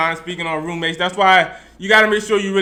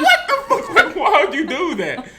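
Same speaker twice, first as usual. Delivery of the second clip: high-pitched disbelief through laughter; shocked laughing outburst; 7 semitones higher than usual. Retold or imitated. Roommate retelling his shock that Beans let her in. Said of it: "I was like".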